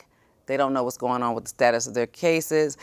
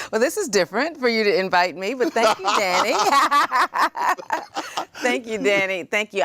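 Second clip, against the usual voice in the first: laughing flustered thanks; 9 semitones higher, 3 dB louder than usual. Real, not acted. Flattered by surprise invitation.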